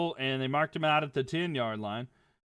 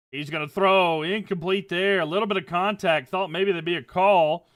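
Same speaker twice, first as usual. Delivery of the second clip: louder, higher live football commentary; excited play call; 4 semitones higher, 8 dB louder than usual. Acted. Calling the play for stream viewers, rising excitement. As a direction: call the pass as it happens.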